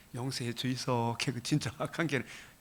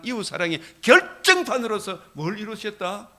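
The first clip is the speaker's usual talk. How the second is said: louder and higher, fervent preaching cadence; preaching at full force; 4 semitones higher, 7 dB louder than usual. Acted. Pastor urging the congregation on love of God, full-force preaching. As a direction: drive each phrase home with rising fervor.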